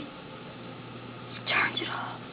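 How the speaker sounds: slow hushed whisper. Pretend. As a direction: whisper it like a secret. Whispered after a gasp.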